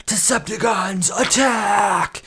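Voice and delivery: high commanding villain shout; Megatron battle cry. Retold or imitated. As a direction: bark the order like the robot villain. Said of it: "like when Megatron says".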